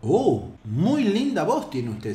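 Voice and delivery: ordinary delivery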